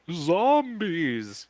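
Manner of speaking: high, loud ghostly moan; hammed-up spooky zombie warning. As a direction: draw it out like a ghost story.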